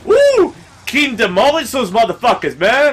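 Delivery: very high loud whoop; jubilant cheer. Real, not acted. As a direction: cheer wildly for the win. Ally kills enemies.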